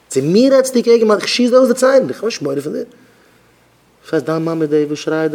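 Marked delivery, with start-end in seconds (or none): 0.1-2.9 high, indignant mock threat; acted angry threat. Warning someone who insulted his father, angry and high. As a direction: threaten as if defending family honour.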